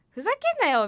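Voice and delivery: high-pitched retold outburst; mock indignation. Commuter held up at the ticket gate, mock indignation. Card gate beeps for person ahead.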